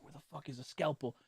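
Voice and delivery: hushed, under the breath; furtive aside. Retold or imitated. Looking around when no one watches, furtive hush. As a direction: drop it very soft, as if secretly searching.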